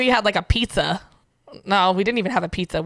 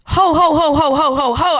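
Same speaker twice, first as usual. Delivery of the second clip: louder, higher ho ho ho; mock Santa laugh; 5 semitones higher, 5 dB louder than usual. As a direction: do a playful Santa ho ho ho. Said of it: "there was, like".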